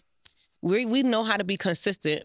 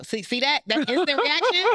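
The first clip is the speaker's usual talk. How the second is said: instant reaction; 9 semitones higher, 4 dB louder than usual. Real, not acted. Points out her own emotional reaction.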